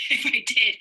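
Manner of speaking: a rueful little laugh through the words. Real, not acted. Mother recalling tear-soaked spaghetti, laughing ruefully.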